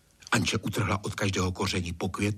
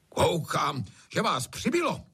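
high, abrupt blurt in place of greeting; gruff hoot; 7 semitones higher than usual. A narrator's reading. Master barges in without greeting, high rude hoot. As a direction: hoot it out rudely. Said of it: "houkl místo křesťanského pozdravení".

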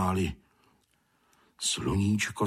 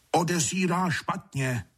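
high call into someone's ear; mild storybook shout; 10 semitones higher than usual. A narrator's reading. Stulík to his companion amid bird noise, lightly shouted. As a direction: half-shout it, pitched up high. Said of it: "zakřičel Blatouškovi do ucha Stulík".

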